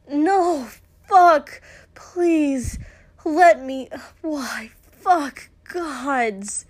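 high, very loud broken cries; frantic pleading outcry; desperate cries. Acted. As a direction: cry out each word in anguished need. Crying out in intimate scene.

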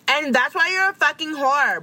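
high-pitched imitation of an insult; mock outburst. Celebrity imitated when the show comes around, mock bitchy outburst. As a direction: throw the insult out high, hammed-up.

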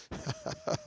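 quiet, breathy little laugh; soft chuckle. Real, not acted. Preacher pausing before asking if they understand, soft chuckle. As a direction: chuckle softly to yourself. Laughs to self mid-sermon.

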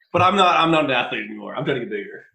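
laughing. Real, not acted. Caught out, laughing at himself.

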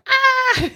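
very high, loud mock scream; short high-pitched shriek of mock terror; imitated scream. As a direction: scream like a panicked driver, playfully. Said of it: "screaming in the car".

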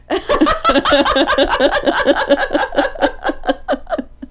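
long high-pitched laugh; high giggle. Real, not acted. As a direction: laugh it off, squealing. Laughs at own joke.

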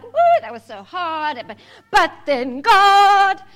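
loud, high, sung-out preacher flourish; mock dramatic preacher. Preacher mocking sermons after breakthrough, hammed-up sung flourish. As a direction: belt it like a showy preacher. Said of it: "make it sound like".

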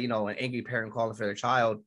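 usual speaking voice

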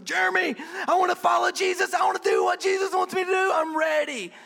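high, loud, fast, bursting with eagerness; hyped-up fan of the church yelling his pastor's name; eager shout. Acted. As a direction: shout it out like an overexcited new believer.

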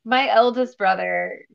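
ordinary talk, nothing marked

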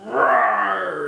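mock battle roar; playful roar from the girls. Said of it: "they're all like".

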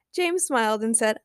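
plain reading voice

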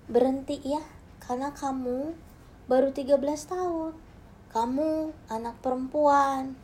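gentle mother. Retold or imitated. Mother pleads softly at bedside for daughter to quit. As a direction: coax her gently like a loving mother. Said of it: "ibu saya bilang dengan lembut".

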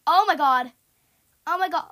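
loud, alarmed outburst; panicked shout. Real, not acted. Gamer spotting chasing heads, panicked shout.

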